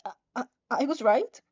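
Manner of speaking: nervous stammer on the repeated I; a flustered mumbling stammer. Acted. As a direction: trip over the first words, flustered. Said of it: "you stammer".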